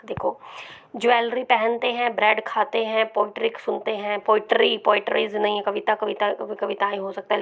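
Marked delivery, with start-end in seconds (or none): none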